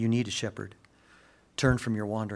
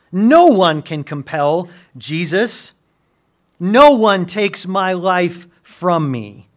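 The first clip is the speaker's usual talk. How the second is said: loud, high, driving sermon voice; preaching at full force; 7 semitones higher, 12 dB louder than usual. Acted. Pastor reading Jesus' words to the church, preaching loudly. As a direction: pound the verses home to the congregation.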